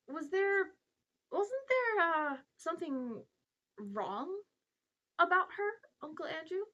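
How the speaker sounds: put-on high, halting boy's question; hesitant boy. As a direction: feel your way nervously into an awkward question. Boy hesitantly asks an awkward question.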